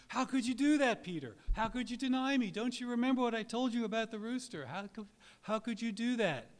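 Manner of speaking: high, loud pleading cry of reproach; imagined angry outcry. Pretend. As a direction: cry out in hurt reproach, voice far up. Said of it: "jumping up and down and waving his hands".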